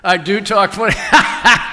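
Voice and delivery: laughing. Real, not acted. Author answers audience remark about his voice, laughing. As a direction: laugh as you own up to it. Audience says he talks funny.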